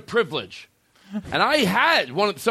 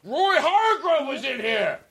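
excited announcing; 14 semitones higher than usual. Real, not acted. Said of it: "announcing the tribute loudly".

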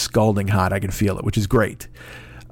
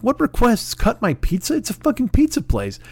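pitched way up, incredulous; indignant retort; 10 semitones higher than usual. Retold or imitated. Scoffing at his friend's complaint, voice shooting up. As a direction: squeak out the disbelief.

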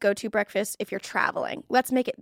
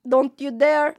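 put-on Filipino accent; 3 semitones higher than usual. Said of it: "my Filipino accent".

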